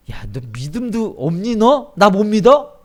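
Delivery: loud, rising challenge; mock angry scolding. God imagined rebuking Gideon for lacking faith, angry scolding. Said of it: "화를 내시지 않고요".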